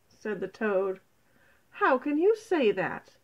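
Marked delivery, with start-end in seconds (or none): 1.7-3.1 indignant demand. A narrator's reading. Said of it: "demanded the mice".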